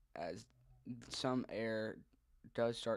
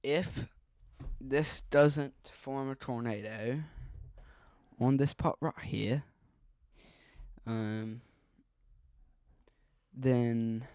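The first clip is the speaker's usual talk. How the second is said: put-on louder, light Australian accent; 8 dB louder, slower than usual. Warning viewers of a possible tornado, light Australian accent. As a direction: slip into a slightly put-on Australian accent, loudly. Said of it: "I keep talking in an Australian accent".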